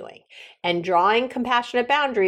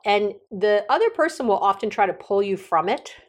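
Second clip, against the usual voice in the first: normal talking voice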